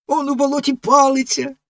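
put-on high, lively boyish voice; a boy wondering aloud at the stick in the bog; excited boy. A narrator's reading. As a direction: point it out with curiosity. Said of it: "вигукнув один із хлопців".

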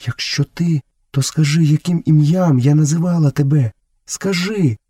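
anxious woman. A narrator's reading. Woman anxiously tests the returning son.

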